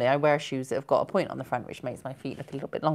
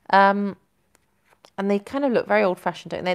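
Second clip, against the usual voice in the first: usual speaking voice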